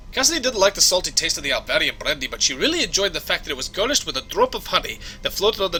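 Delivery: conversational Scottish brogue; Scottish accent. Acted. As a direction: offer help as a Scottish engineer. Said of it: "my Scottish accent needs some work".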